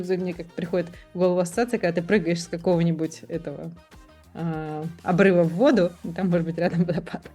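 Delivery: ordinary talk, nothing marked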